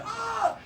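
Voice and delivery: short high-pitched mock scream. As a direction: imitate a crowd's scream without full volume. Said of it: "the place starts screaming".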